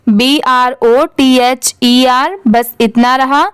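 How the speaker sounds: usual speaking voice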